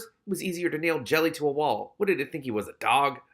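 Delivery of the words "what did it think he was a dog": plain reading voice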